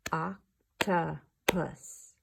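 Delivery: very slow, OC-TO-PUS split apart; clapped syllables. Shown as a demonstration. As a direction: drag "Octopus" out, syllable by syllable. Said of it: "Let's clap out octopus together".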